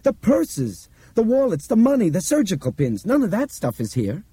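high-pitched eager listing; excited shout. Acted. Albert lists what is missing from the terminal, excited shout. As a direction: rattle off each discovery in a high voice. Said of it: "he shouted".

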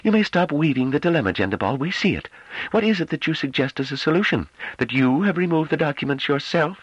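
high, clipped impatience; impatient. A narrator's reading. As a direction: cut him off, impatient. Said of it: "broke in impatiently".

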